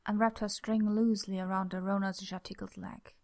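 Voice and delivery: ordinary delivery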